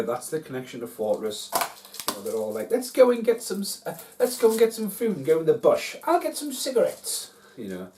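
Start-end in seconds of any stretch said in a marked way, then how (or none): 2.7-7.3 high, loud mock Kiwi accent; New Zealand Kiwi accent. Host imitating the film's kids in the bush, mock Kiwi accent. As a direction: put on an exaggerated Kiwi accent, pitched right up. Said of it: "New Zealand Kiwi accent".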